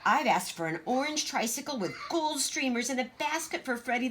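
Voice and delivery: put-on high, bossy little girl voice; Greta, boastful child. Big sister boasting to Gracie, high and bossy. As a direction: show off being taller.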